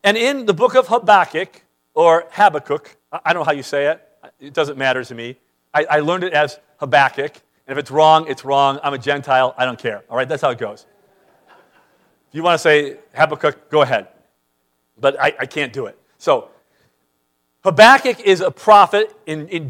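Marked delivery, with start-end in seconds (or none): none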